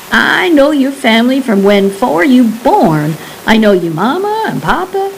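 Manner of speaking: lightly voiced Cajun old man, scoffing at the idea; old Cajun recluse. Old Jean Pearl scoffs at the guide's question, lightly Cajun.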